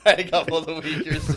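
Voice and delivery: says it while laughing; giggling through the repeat. Real, not acted.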